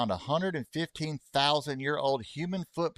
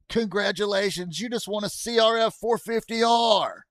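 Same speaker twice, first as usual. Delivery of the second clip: high-pitched giveaway announcement; mock prize announcer; 9 semitones higher, 4 dB louder than usual. Streamer pretending a viewer won a bike, excited high announcer. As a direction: announce a pretend big win.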